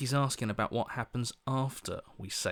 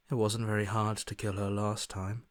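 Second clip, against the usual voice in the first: usual speaking voice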